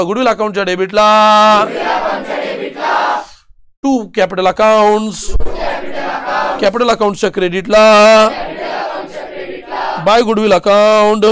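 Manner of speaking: teacher leading a loud chanted drill; loud recitation. Shown as a demonstration. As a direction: chant the journal entry loudly, a touch sung. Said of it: "तोंडाने बोला, मोठ्याने बोला".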